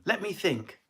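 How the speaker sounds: ordinary talk, nothing marked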